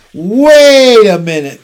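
long, high, loud shout; crying out. A narrator's reading. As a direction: stop everyone with a stretched-out yell. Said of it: "cried Avery".

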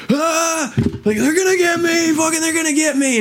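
hammed-up shell-shocked terror; a shell-shocked soldier freaking out in the bar, done as high mock panic. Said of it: "he's just like".

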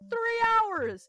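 high, loud outraged repeat; three hours yelled in outrage; exasperated. Retold or imitated. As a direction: blurt it out in disbelief. Repeats louder in disbelief.

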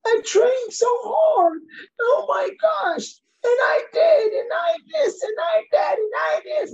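high, wailing sob-shout; hammed-up crying complaint; mock crying athlete. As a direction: imitate a sobbing athlete who trained so hard. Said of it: "I've seen people burst into tears".

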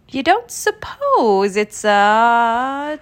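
slow, drawn-out suspense; tentative beginning. A narrator's reading. Tigger guessing what took the honey, slow suspense. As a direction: stretch the last word and leave it hanging. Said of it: "Tigger began".

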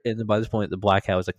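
ordinary delivery, nothing marked

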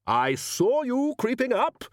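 high, drawn-out accusing roar. A narrator's reading. Arch-Chancellor catching a gnome creeping up, roaring. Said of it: "roared the Arch-Chancellor".

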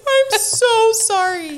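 high, loud, drawn-out mock wail; mock sobbing. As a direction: wail the apology slowly, imitating yourself in tears. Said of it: "started sobbing".

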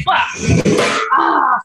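real cry after stubbing a foot; high, breath-filled yell of pain; pained yelp. Real, not acted. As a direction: yelp as your foot hits a chair. Kicks over a chair.

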